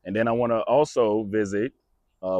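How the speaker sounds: ordinary talk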